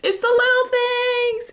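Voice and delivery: gushing. Real, not acted. Gushing over small joys.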